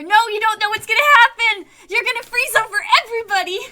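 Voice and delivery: high, loud and fast panic; shout. Acted. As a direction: yell through the door in panic. Player yelling through door.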